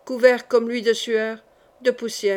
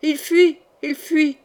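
high, raised alarm call; crying out; 6 semitones higher, 5 dB louder, slower than usual. A narrator's reading. Sentries spot Henri fleeing on horseback, crying out. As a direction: call the alarm out higher and louder. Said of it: "quelques sentinelles se retournèrent en criant".

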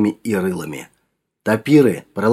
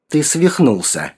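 plain reading voice

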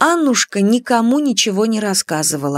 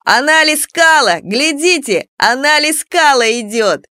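high, raised-voice bully's jeer; yelled taunt; 6 semitones higher than usual. A narrator's reading. Bully spots Аннушка and jeers to everyone, yelling. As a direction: call the others over with a mocking holler. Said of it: "орал".

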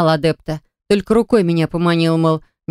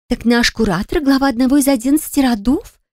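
excited whisper; 6 semitones higher than usual. Acted. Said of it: "восторженно зашептала стоящая рядом Стина".

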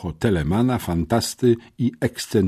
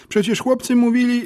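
put-on high boy voice; 4 dB louder than usual. A narrator's reading. Smerf protests in his voice.

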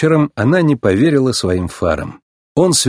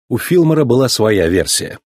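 plain reading voice

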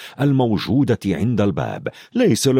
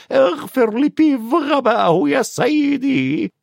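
very high, grovelling ghost voice; fawning Peeves; 13 semitones higher than usual. A narrator's reading. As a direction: flatter the Baron, oily and shrill-free pleading. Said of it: "وقال مداهنا". (بيف متملق بصوت عالٍ جدا)

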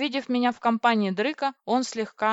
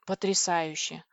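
plain reading voice

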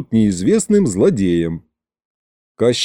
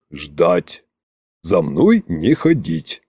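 низкий медленный царский приказ; прикажи низко и весомо, не повышая голоса; властный Кощей; 8 semitones lower, slower than usual. A narrator's reading. Koschei orders them to wait. (низкий повелительный голос)

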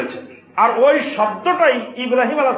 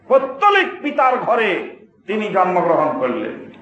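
ordinary talk

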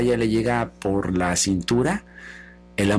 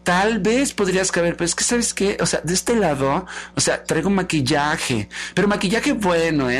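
higher, affected mock regret; vain socialite; 4 semitones higher than usual. Vanity refusing drowning Love, affected and vain. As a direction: gush insincerely, full of yourself.

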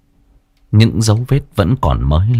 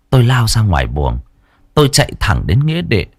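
ordinary delivery

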